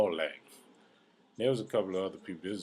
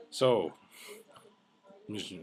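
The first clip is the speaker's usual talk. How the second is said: usual speaking voice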